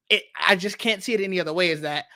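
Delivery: normal talking voice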